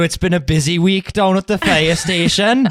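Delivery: mock Welsh fireman voice; Welsh accent. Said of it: "your Welsh voice".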